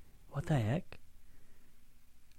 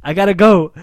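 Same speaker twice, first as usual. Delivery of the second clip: shouting; 5 semitones higher, 17 dB louder than usual. Retold or imitated. Calling out to Michael.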